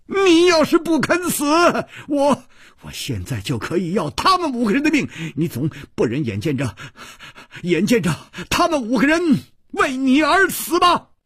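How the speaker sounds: high, frantic, stammering threat; shout, falter, then scream the last words; desperate, rapid fury in a strained high voice; angry shouting. A narrator's reading. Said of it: "他怒喊道".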